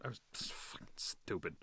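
breathy whispered self-reproach; whispered aside. Real, not acted. Admitting his own fault, whispered. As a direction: mutter it under the breath.

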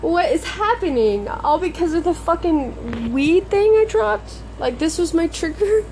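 high-pitched, bewildered venting; exasperated disbelief. Real, not acted. Venting about the dropped weed, high exasperated disbelief. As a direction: pitch climbs in baffled protest.

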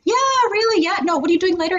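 very high, gushing chat; high-pitched, hammed-up friendliness; mock overly friendly. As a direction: imitate someone being far too friendly. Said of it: "you're being overly friendly".

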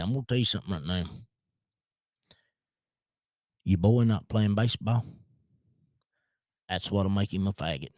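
put-on lightly done country accent, long pauses; country accent impersonation. Coworker warns dad about the son, light country accent impersonation. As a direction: imitate a country guy laying down a verdict. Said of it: "I do a really good impersonation".

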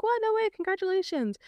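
imitated delighted coworker, high pitch; retold happy surprise; excited congratulations. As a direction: gush the good news high. Coworker reacting to engagement news.

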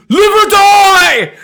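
high, drawn-out battle cry; imitating a loud movie ultimatum; mock karate-movie shout. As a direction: bellow it like a martial-arts threat. Repeating karate threat.

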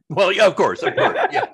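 words breaking into a high laugh. Real, not acted. Host amused by guest's answer, laughing through words. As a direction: chuckle through the yeahs.

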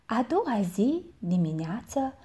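usual speaking voice

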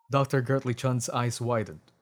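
ordinary delivery, nothing marked

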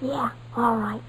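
whispered agreement; nervous kid whispering his agreement mid-heist. Acted. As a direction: whisper it so nobody hears. Sneaking up to steal apples.

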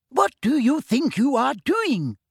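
put-on a stern old librarian pouncing on pupils; angry librarian. A narrator's reading. Librarian catches them eating chocolate.

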